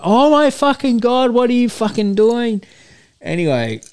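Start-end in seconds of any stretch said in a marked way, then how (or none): 0.0-2.6 put-on shocked girlfriend. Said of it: "her friend's like".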